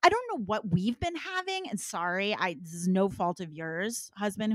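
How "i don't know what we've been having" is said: stunned wife discovering something new, way up high; amazed disbelief. Retold or imitated. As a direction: pitch leaps much higher in astonished disbelief. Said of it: "It was like".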